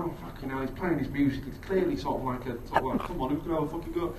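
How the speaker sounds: tense muttering. Retold or imitated. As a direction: mutter it so nobody on the train hears. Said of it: "sort of going".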